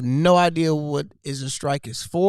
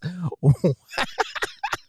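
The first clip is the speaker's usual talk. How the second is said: real laugh. Real, not acted. Cracks up at the joke.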